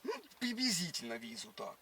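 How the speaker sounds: ordinary talk, nothing marked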